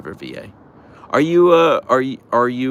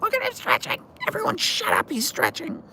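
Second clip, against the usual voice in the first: much higher pet-talk squeal. Real, not acted. Baby talk to the stretching dog.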